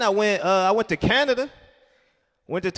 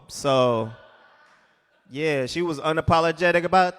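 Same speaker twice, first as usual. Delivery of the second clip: usual speaking voice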